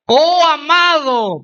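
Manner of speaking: high, drawn-out gushing "Oh, amado"; effusive greeting. Retold or imitated. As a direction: gush it like an overly sweet church leader. Youth leader greets him effusively.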